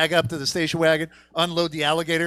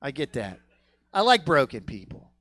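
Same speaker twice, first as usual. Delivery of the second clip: ordinary delivery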